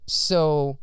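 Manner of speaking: ordinary delivery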